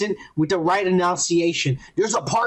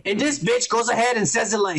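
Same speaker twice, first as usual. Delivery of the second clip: ordinary talk, nothing marked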